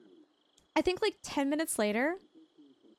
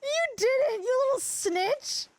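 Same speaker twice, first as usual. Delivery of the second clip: high-pitched playful accusation; squealed mock outrage, drawn out at the end; mock scolding; 11 semitones higher, 3 dB louder, slower than usual. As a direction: scold a tattletale in a high, put-on voice. Mock-scolding a tattling chatter.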